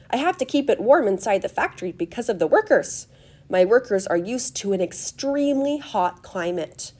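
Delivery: emphatic, swooping guide's patter; animated, fluty showman explaining; eccentric host, eager. Acted. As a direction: usher them in, stressing words dramatically. Story figure guides guests inside.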